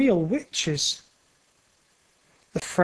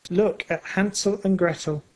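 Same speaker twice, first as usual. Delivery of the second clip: ordinary delivery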